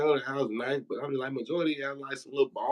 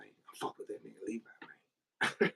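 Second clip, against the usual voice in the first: soft muttered echo; 2 semitones lower, 9 dB quieter, slower than usual. Retold or imitated. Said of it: "He used to always say".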